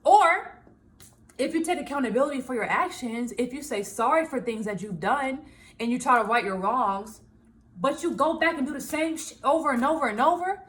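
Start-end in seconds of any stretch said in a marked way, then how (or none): none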